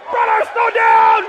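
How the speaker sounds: loud, drawn-out high yell; slow and loud, yelled right into his ear; shouted plea. Retold or imitated. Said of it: "stick it behind my mouth".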